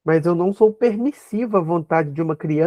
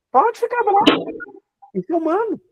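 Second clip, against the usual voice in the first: mock gentle allowance; 12 semitones higher than usual. Calm line told to child.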